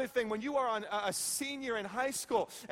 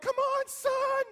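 much higher, loud, drawn-out courtside call; a parent hollering encouragement to a son; cheering parent; 14 semitones higher, 5 dB louder, slower than usual. Retold or imitated. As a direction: yell it from the sidelines like a proud parent. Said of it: "run up and down the court".

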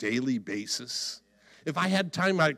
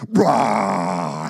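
low, rasping imitated roar; long growled roar; lion roar; 9 semitones lower, 5 dB louder than usual. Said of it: "young lion comes and roars at you".

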